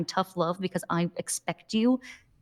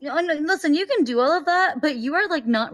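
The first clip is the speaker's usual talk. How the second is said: high, louder, insistent cut-in; insistent interruption; 9 semitones higher, 5 dB louder than usual. Acted. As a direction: cut in to correct the junior lawyer. Judge cuts in to scold attorney.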